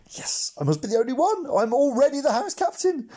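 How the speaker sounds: giddy. A narrator's reading. Boy imagines he is house captain, giddy. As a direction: thrill at winning without a fight.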